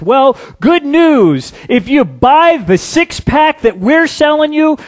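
high, loud, hammed-up beer-ad hype; mock ad pitch. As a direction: ham it up like a pushy beer commercial. Mocking a beer commercial.

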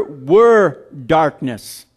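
"were darkness" slowed with "were" stressed; emphasis on were. Shown as a demonstration. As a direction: hit "were" and stretch it. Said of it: "There's a difference between in the darkness and being dark".

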